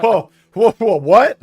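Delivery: loud, high startled outburst; losing it. Retold or imitated. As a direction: retell him losing it, voice jumping up. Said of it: "he lost it".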